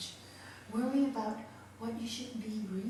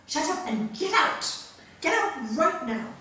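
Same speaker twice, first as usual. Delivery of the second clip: loud, higher angry shout; retold shouting; 6 semitones higher, 7 dB louder than usual. Master ordering rude student out, angry shout. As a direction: bark the order out sharply. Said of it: "not used to him shouting so loud".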